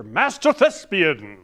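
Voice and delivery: slow, loud, grandly overblown name; Master Thespian. Retold or imitated. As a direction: announce it like a ham actor. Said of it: "his character".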